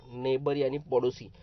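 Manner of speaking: ordinary delivery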